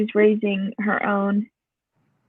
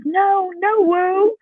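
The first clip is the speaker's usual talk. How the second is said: put-on high toddler "no, no, woo"; lightly voiced speech-delayed child; high little protest; 8 semitones higher, much slower than usual. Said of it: "best way he could say it".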